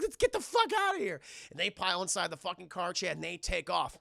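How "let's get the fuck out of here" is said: high-pitched frantic urging; mock panic. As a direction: imitate someone desperate to flee. Voicing frantic escape from dog.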